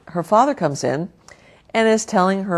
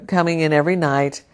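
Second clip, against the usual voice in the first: ordinary talk, nothing marked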